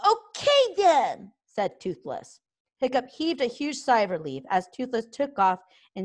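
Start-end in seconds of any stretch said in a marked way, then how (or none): 0.1-1.3 high, slow dragon voice; small dragon agreeing. A narrator's reading. Toothless agreeing to hunt for Hiccup, squeaky.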